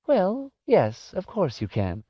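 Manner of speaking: put-on high old-lady character voice; high-pitched old woman, answering a child; old woman's voice. A narrator's reading. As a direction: lift the voice high for an elderly woman. Voices Missus Griffin in dialogue.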